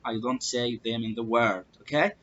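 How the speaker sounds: usual speaking voice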